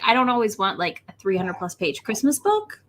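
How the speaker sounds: usual speaking voice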